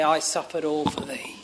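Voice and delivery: a soft, breathy whisper of the Saviour's words; whispered quote. Acted. Preacher voicing the Saviour to the congregation, whispered. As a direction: let it fall to a bare whisper.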